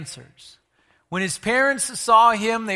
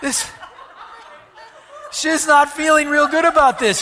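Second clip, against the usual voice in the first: ordinary delivery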